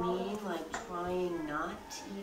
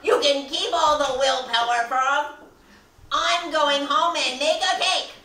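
loud, high, indignant Toad voice; defiant Toad; 11 semitones higher, 18 dB louder than usual. Acted. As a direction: declare it defiantly at full volume.

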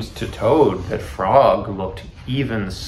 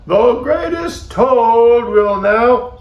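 high, loud, slow stage announcement; strange announcer voice; 12 semitones higher, 7 dB louder, slower than usual. A narrator's reading. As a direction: announce the daring act grandly, drawn out. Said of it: "Then the voice said".

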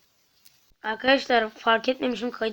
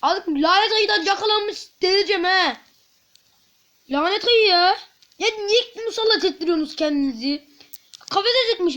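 louder and higher, fed-up shouting; frustrated yell; 7 semitones higher, 6 dB louder than usual. Real, not acted. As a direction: rant at the game's cursed bear. Caught by the bear again.

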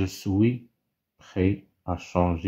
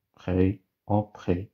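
slow spelling-out of près; slower than usual. Shown as a demonstration. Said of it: "avec S à la fin".

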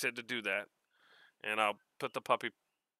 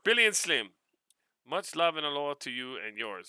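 ordinary delivery

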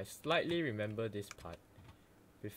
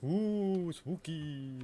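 mock spooky; 8 semitones higher, 7 dB louder, much slower than usual.

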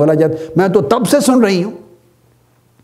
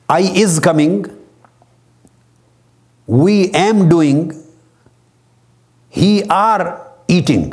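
slow, drawn-out ungrammatical English; deliberately wrong English; much slower than usual. Shown as a demonstration. Lecturer showing students wrong English, slow and mocking. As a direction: say the broken grammar slowly so it jars. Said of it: "یوں کر کے بول دے".

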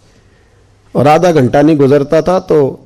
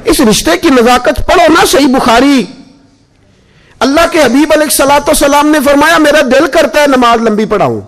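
higher, impassioned sermon voice; full-force preaching; 8 semitones higher than usual. Acted. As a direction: preach it to the crowd, fervent. (जोशीला, ऊँची आवाज़ में was)

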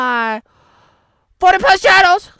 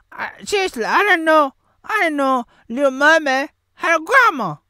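ordinary talk

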